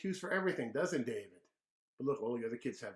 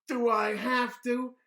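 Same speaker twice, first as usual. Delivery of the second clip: high, loud, slow whine; dragged sulky childish whine; whiny kid complaint; 10 semitones higher, 9 dB louder, slower than usual. Acted. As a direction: drag it out like a sulky boy. David whines about breakfast.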